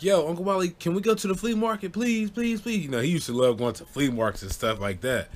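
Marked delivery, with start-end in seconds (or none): 0.0-2.8 put-on high, whiny kid voice pleading; begging little brother. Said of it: "my little brother Randy's like".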